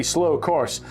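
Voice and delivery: ordinary delivery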